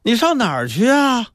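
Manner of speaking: high, drawn call from mother; calling after him. A narrator's reading. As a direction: call out to someone running off. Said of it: "他妈在后边喊他".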